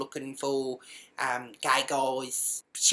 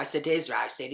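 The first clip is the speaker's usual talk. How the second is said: ordinary delivery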